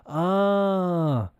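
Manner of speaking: drawn-out puzzled ah; a puzzled listener's long ah; confused "ah". Retold or imitated. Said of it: "then they go".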